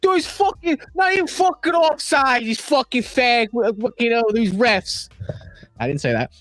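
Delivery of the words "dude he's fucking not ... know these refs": high, strained mock yell of a furious fan; angry New York fan. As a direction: squeal it like a drunk fan raging at the refs. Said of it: "Some New York guy goes".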